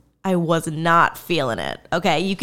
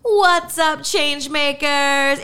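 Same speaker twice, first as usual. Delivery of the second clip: excited greeting; 6 semitones higher, slower than usual. Acted. Podcast greeting, calling out.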